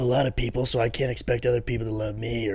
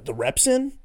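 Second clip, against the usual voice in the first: ordinary talk, nothing marked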